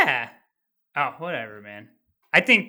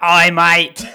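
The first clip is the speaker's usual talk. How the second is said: loud hammed-up Antarctica accent; mock Antarctica accent; 11 dB louder than usual. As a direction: belt out a greeting in a made-up Antarctica accent. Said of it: "Antarctica, it's like".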